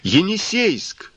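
high drawn-out call from the stern; shout. A narrator's reading. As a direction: call the ship's name out long and high. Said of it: "кто-то крикнул с кормы".

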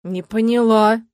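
loud, puzzled reaction; baffled and caught short; taken aback. A narrator's reading. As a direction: say it dumbfounded. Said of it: "опешила Стелла".

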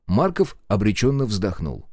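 usual speaking voice

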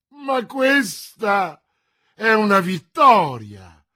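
high, loud, beaming cry of victory; a king bursting with joy; radiant exclamation. A narrator's reading. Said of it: "esclamò il re raggiante". (esclamazione raggiante, alta e forte)

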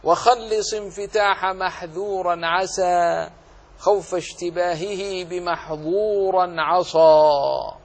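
measured chant of a tajweed poem with long line endings; verse recitation. Shown as a demonstration. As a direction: recite the teaching verse, drawing out the rhyme. Recites the verse being taught. (إنشاد بيت من منظومة التجويد بتأنٍّ)

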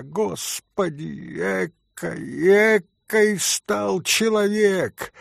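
slow, high lament; ashamed dismay. A narrator's reading. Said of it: "покраснела от стыда". (горестное, протяжное сокрушение)

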